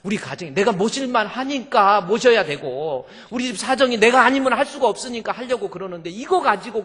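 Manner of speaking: loud, high aggrieved protest; wronged wife. Retold or imitated. Wife defending her care for her mother, loudly aggrieved. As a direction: plead your case as the wronged wife. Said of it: "아내 입장에서 정말 억울한 거예요".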